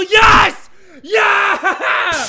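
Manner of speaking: very high, loud celebratory shout; triumphant scream. Real, not acted. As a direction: scream it in victory. Reaches ladder to next level.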